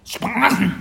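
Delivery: put-on high, loud baby-dragon voice; squeaky creature reply; little creature echoing. A narrator's reading. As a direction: parrot the word like a tiny beast. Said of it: "entgegnete das Wesen".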